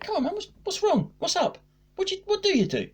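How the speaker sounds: high, soft coaxing voice; feigned concern. Retold or imitated. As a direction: coax gently, making out you really care. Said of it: "trying to make out I sort of".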